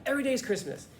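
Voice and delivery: high, exuberant imitation of a happy friend; Andy. Coach showing how his friend used to say it, exuberant. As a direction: sing out the catchphrase with delight. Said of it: "like that".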